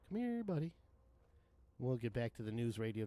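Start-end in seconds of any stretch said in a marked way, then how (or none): 0.1-0.7 sing-song higher taunt, falling at the end; higher coaxing call. Real, not acted. Gamer taunting the enemy he's fighting, higher coaxing call. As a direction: lure the monster in like calling a pet.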